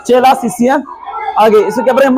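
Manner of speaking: ordinary talk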